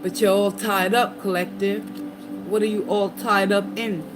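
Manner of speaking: ordinary delivery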